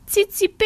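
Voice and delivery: heller, sehr hoher Vogelruf „Zizibe“; „Zizibe“ hoch und fröhlich zwitschern; Meisenruf. Acted. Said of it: "rief die Meise". (Vogelruf „Zizibe“, ganz hoch gezwitschert)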